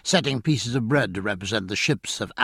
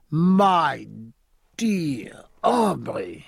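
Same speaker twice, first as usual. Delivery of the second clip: louder, drawn-out warm welcome; delighted greeting; 6 semitones higher, 3 dB louder, much slower than usual. A narrator's reading. French captain embracing an old enemy, delighted drawn-out welcome. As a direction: stretch out the welcome with joy. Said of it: "he cried".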